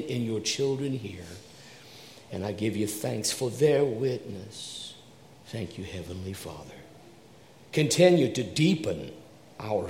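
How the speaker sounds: low, soft, reverent prayer voice; pastoral prayer. Acted. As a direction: pastor leading the congregation in hushed prayer. Leads prayer.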